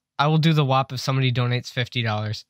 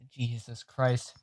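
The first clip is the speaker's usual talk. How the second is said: usual speaking voice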